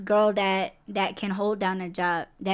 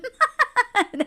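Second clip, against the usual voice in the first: high-pitched burst of laughter; laughing; 13 semitones higher than usual. Real, not acted. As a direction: crack up at the gossip. Joking about women fighting over dude.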